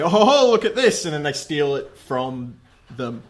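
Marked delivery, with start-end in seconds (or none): 0.0-1.0 higher, louder gleeful cry; gleeful discovery. Retold or imitated. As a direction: pounce on it gleefully. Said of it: "they say".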